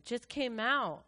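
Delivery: normal talking voice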